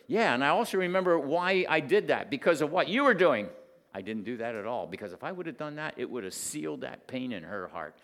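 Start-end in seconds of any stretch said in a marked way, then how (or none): 0.1-3.5 loud, higher self-justifying snap; defensive retort. Retold or imitated. Father imagining a defensive answer to his daughter, loudly. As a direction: push back hard on the daughter. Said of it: "I could have told her".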